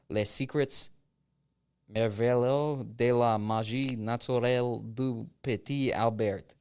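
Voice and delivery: slow, put-on French accent. Reading a grimoire title to a friend, French accent. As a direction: read it out slowly in an amateur French accent. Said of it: "It's my French accent".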